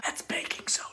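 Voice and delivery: soft confiding whisper; whispered aside. Acted.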